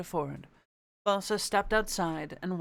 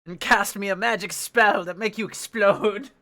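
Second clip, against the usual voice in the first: hammed-up threat; 3 semitones higher, 7 dB louder than usual. Playing threatening old wizard character.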